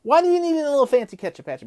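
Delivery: fast, high, exasperated rattle; rapid-fire repeat. Real, not acted. Vlogger repeating his complaint about the customer, rapid-fire.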